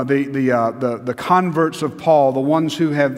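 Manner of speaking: ordinary talk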